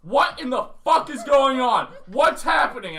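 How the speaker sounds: panicked yell. Retold or imitated. Banging his head, yelling at silent roommate in panic. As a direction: yell into the dark room in alarm. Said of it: "I'm like".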